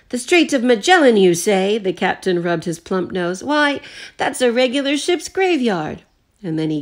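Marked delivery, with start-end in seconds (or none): 0.1-1.8 high, loud feigned astonishment; captain plays innocent to the butler; mock surprise. 3.4-6.0 high, loud mock indignation; captain protests to the passengers; blustering old captain. Captain feigns alarm.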